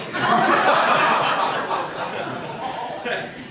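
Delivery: loud burst of laughter; hearty laugh. Real, not acted. Townsfolk laugh at Gary's joke, hearty laugh.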